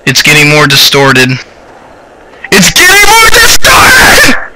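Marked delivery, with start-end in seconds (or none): 2.5-4.5 very high, drawn-out shriek; screaming like a woman. Real, not acted. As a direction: scream it in real terror, voice leaping way up. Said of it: "I scream like a woman".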